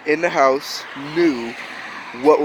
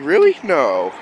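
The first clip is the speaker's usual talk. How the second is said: sarcastic surprise. Retold or imitated. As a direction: feign shock like adults playing along. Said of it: "sarcasm was not my biggest strong point".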